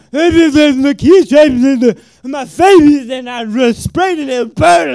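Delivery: high, loud, slow strained voice; pained explaining. Retold or imitated. Maced son explaining to his dad, high and strained. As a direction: push the words out through the burning.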